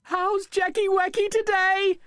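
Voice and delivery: high, fond auntie voice greeting the dog; Auntie Tidge's voice. Acted. Auntie Tidge greeting her dog Jack, high and fond. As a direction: coo it like a doting aunt. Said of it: "Auntie Tidge is the only person who calls me that".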